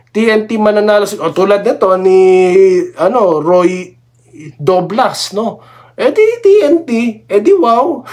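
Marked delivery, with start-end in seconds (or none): 5.9-8.0 much higher, mock-impressed "eh di wow"; sing-song teasing put-down of the rival fan; sarcastic mockery. Real, not acted. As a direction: jeer at the rival fan's boast. Mocking a rival fan's prediction.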